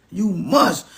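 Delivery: ordinary talk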